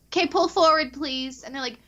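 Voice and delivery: high-pitched imitation of a worker; drive-thru worker. Guest imitates worker at Burger King, pitched high.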